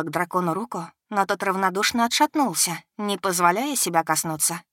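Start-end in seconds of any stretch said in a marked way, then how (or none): none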